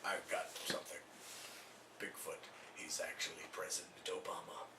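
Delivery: hushed, very low reveal; confiding Ventura. Acted. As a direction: drop to a secretive murmur. Ventura confides in his team.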